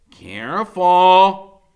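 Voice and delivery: loud, very slow warning; warning Freak. A narrator's reading. Freak warning his friend about his tongue, slow loud. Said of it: "he says".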